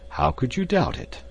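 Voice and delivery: high-pitched exclamation of a question; exclaimed. A narrator's reading. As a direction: exclaim it as if doubt were absurd. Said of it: "exclaimed Professor Porter".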